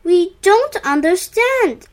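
puzzled, with amazement lifting the voice; amazed. A narrator's reading. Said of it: "cried in amazement".

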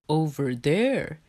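a hint of a simple bear voice; Bear character voice. A narrator's reading.

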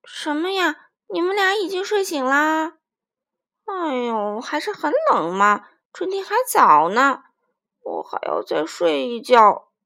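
a hint of a drowsy frog wanting sleep; lightly voiced sleepy frog. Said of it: "青蛙眨巴着眼睛说".

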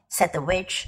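ordinary delivery

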